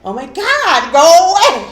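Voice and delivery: building to loud mock yell; imitating exasperated mom. Mom shooing off housekeeping, imitated exasperated yell. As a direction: get rid of the pest, half laughing. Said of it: "she's like".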